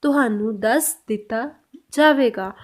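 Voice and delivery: slow, word-by-word Punjabi; slow Punjabi model. Shown as a demonstration. Teacher to learners, a slow Punjabi model. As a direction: stretch out तुहानू दस दिता. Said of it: "इसको पंजाबी में बोलते हैं".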